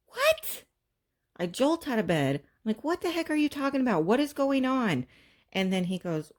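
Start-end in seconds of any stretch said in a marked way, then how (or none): 0.1-0.6 high, loud disbelieving what; shocked what. Retold or imitated. Mom startled awake by husband's hospital news, shocked disbelief. 2.8-5.1 higher, louder panicked questions; alarmed demand. Retold or imitated. Mom leaping out of bed at husband's news, alarmed high questions.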